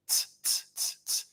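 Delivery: "tss" as soft unvoiced tongue-tip clicks; whispered T sounds. Shown as a demonstration. Instructor demos the T tongue position to divers, soft unvoiced "tss". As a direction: breathe out "tss, tss" with the tongue tip on the upper teeth. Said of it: "like if you're pronouncing the letter T".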